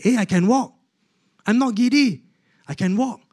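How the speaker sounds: high, delighted realisation; retold astonishment, pitched right up; amazed discovery. Patient standing up after surgery, amazed and excited.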